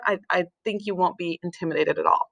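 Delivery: ordinary talk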